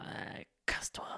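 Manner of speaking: weak, whispered brush-off; faint whispered brush-off; grumpy dying whisper. Pretend. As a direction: whisper it like a bitter dying man. His imagined dying retort.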